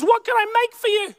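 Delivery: high, quick motherly offer; imitating an eager mum fussing over her child; high-pitched, hurried hospitality; doting mum. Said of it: "Mum says".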